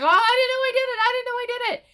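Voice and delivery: very high, loud squeaky panic; mock panicked voice. Abby retold as terrified, squeaky high panic. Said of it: "She's like".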